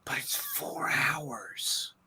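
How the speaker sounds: breathy whispered aside, barely voiced. Host whispering to co-hosts about runtime, hushed. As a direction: whisper it like a shocked secret. Pondering the four-hour runtime.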